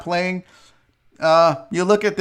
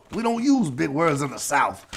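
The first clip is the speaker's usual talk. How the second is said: put-on soft, lower Southern accent; 5 semitones lower, 5 dB quieter than usual. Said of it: "I don't know why I went Southern accent on that".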